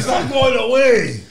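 high loud panicked laugh-yell; laughing shout. Real, not acted. Guest yelling that the burn won't stop.

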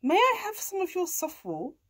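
high rising request; cat asking. A narrator's reading. Cat asking a farm animal for things, high cat voice. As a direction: ask politely in a lifted cat voice. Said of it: "said Kat".

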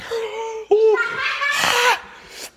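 very high shouted laugh; pained shouting laugh. Real, not acted. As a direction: laugh out the burn. After hot pepper.

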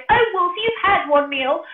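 high, loud squealing piggy plea; a pig pleading for its life, high and squealing. Acted. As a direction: beg the wolf as a frightened little pig. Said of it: "He cried".

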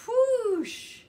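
loud, high, drawn-out whoosh. Acted. Froggy blows out candles, loud whooshing noise. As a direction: imitate a big blast of breath.

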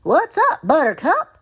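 high, loud playful greeting; cheeky Steve. Acted. Steve surprising Buttercup, high and playful. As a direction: pop up and chirp hello. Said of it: "Steve popped up".

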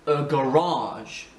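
"garage" said ga-RAAGE, second syllable long; English English stress. Shown as a demonstration. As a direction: draw out RAGE in "garage". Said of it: "C'est ici qu'on accentue". (« garage » à l'anglaise, accentué sur la deuxième syllabe)

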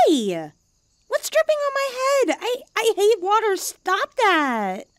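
high, loud, fast startled yelp at the water; cat splashed with water, indignant; startled protest. Acted. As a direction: jump at a sudden drip and push it away. Water drips on her head.

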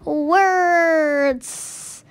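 loud, drawn-out wail; loud, slow comic complaint; mock frustration. Real, not acted. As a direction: stretch each word out in exasperated defeat. Playful frustration at pronouncing words.

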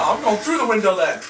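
ordinary talk

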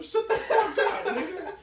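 high, slow shout that breaks into a laugh; half-laughing fake rage; laughing mock yell. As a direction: yell it while cracking up.

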